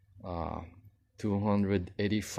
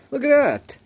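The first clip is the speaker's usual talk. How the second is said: much higher, louder delighted cry; delighted exclaim; 10 dB louder, faster than usual. Real, not acted. Trying on new brogues, thrilled, in a high loud cry. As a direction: burst out in pure delight at the shoe.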